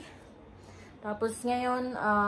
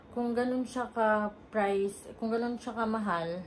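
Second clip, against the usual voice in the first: usual speaking voice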